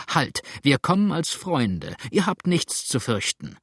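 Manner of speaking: ordinary delivery, nothing marked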